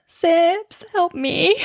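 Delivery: high pleading call for help; pleading cry. Retold or imitated. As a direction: beg a friend for rescue. Calling out to friend for help.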